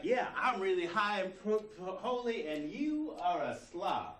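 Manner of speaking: loud, high self-righteous boasting, played for laughs; hammed-up spiritual superiority, looking down on the listener. Acts out a self-righteous ego.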